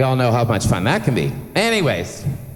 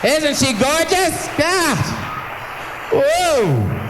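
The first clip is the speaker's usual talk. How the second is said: much higher, slow, swooning praise; gushing excitement; much slower than usual. Real, not acted. MC gushing to the crowd about Miss Canada, excited. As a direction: gush over her like a starstruck fan.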